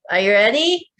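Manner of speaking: ordinary talk, nothing marked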